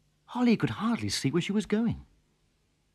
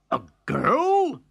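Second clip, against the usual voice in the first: surprised, a bit higher; exclaiming; 6 semitones higher, 4 dB louder than usual. A narrator's reading. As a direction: blurt it out in disbelief. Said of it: "exclaimed the farmer".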